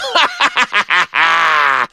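staccato evil stage laugh; villainous cackle. A narrator's reading. Two boys drowned out on stage, villain cackle. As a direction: cackle in a long run of ha's. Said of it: "Glenn and I cackled".

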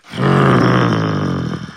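deep disappointed sigh; a long low groan of defeat; big bad wolf sighing, low; wolf's low sigh. A narrator's reading. Wolf sighs, sent away.

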